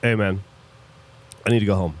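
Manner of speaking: low, gravelly big-guy voice; low mock mountain-man rumble. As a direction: imitate a gruff bearded young man, pitched well down. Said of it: "gravelly voice".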